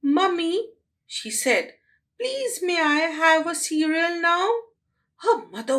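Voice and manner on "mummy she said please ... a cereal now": put-on much higher sweet child voice; sweet polite girl. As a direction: ask it like a good little girl. Girl asks mother politely.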